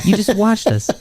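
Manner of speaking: high-pitched indignant dismissal; mock outrage. Co-host as player dismisses watching fans, mock outrage.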